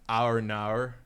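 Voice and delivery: exaggerated Aussie "Our Naur" with R's added; Australian accent. Shown as a demonstration. Joking about a friend's accent, in an Australian accent. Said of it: "improved my Aussie accent".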